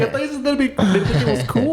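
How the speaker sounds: high, laughing disbelief; half-laughing, caught out; mock surprised protest. To Alex's cousin about the beer, laughing mock surprise.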